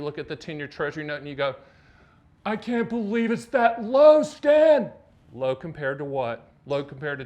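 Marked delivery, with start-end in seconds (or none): 2.5-4.9 loud, high, drawn-out disbelief; mock outrage. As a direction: cry out like an exaggeratedly shocked customer. Mimics customer shocked by rates.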